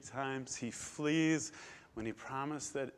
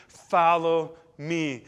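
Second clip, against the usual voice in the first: loud, slow, drawn-out follow me; a louder, slower restated call; commanding call; 4 semitones higher, 13 dB louder, much slower than usual. Shown as a demonstration. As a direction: call out Follow me slowly with full force. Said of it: "He restates the original calling".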